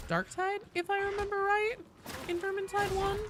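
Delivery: put-on high, airy Valley Girl lilt. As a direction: play a ditzy Valley Girl. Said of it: "the Valley Girl voice".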